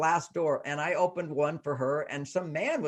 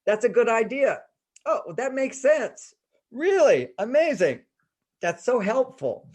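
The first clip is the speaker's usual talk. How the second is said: high, bright-eyed gushing praise with a louder peak on Really; enthusiastic praise; 7 semitones higher, 4 dB louder than usual. Shown as a demonstration. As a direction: cheer him on like a delighted fan. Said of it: "I'm emphasizing three phrases for women to remember".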